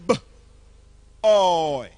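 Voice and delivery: "B-, oy" sounded out in two pieces; pronunciation demo. Shown as a demonstration. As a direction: break "B-, oy" after the B, drawing out the rest. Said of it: "tell you how to pronounce it".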